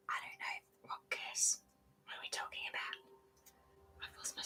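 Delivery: barely audible whisper to oneself; hushed, confused muttering; whispered aside. Real, not acted. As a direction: whisper it under your breath, puzzled. Muttered aside while lost in text.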